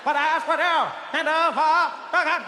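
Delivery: JFK. Acted. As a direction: declaim slowly with odd drawn vowels. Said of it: "He had that thing, it was like".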